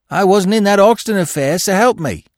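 high, loud protest of innocence; frightened crook's denial; fearful. A narrator's reading. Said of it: "something like fear shaded his face".

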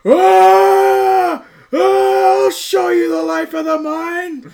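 high, loud screaming John Goodman impression; imitating John Goodman's yell. Friend imitates Goodman running out of a burning hallway, screaming. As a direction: shriek it like a madman charging through fire. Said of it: "Just going".

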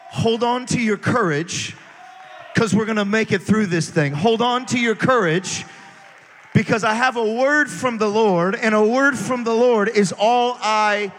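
loud, full-force preaching shout. Acted. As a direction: thunder it out to the congregation.

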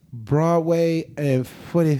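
put-on slow, dragged-out drunk mumble; drunken answer. Drunk girl answering where she lives, slow and slurred. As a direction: imitate a tipsy girl stretching the words out. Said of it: "she said this shit so fucking funny".

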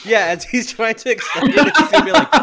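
high laughing voice. Real, not acted. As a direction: giggle through the setup. Laughing setting up joke.

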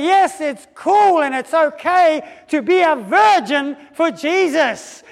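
high, loud and slow, proclaiming to the hall; bold announcement. Retold or imitated. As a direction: call the whole room to attention. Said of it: "and she goes".

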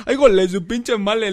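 high, loud, slow startled curse through a laugh; startled passenger imitation. Friend imitates the startled man cursing, high, loud and slow. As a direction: blurt the curse like a man jolted awake. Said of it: "Y hace".

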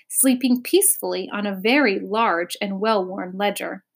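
ordinary delivery

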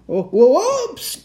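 high, loud, drawn-out slipping yelp; startled cry. A narrator's reading. Santa sliding on ice, crying out. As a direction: cry out as if losing your footing. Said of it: "cried Santa".

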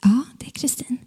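quiet, tentative reply; hushed answer. Retold or imitated. Kristin answering the trembling caller, hushed. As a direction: answer under your breath.

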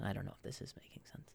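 fast whisper under his breath; quick unvoiced self-doubt aside. Real, not acted. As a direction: mutter it to yourself, barely voiced. Aside to himself.

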